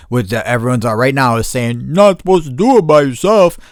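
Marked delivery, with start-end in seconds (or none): 1.9-3.6 higher, mildly mocking quote; mocking naysayer. Mimicking everyone who warns against going solo, mocking. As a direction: parody a disapproving know-it-all, pitched up.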